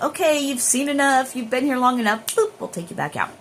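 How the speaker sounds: a breezy guide ending the visit; lightly voiced spirits. Imitating the spirits pulling her back out, lightly voiced and brisk. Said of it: "they're like".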